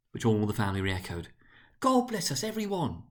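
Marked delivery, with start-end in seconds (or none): 1.8-3.0 put-on high, small child's voice; a frail little boy piping his blessing; Tiny Tim. A narrator's reading. Tiny Tim pipes up at the family table, high and small. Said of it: "said Tiny Tim".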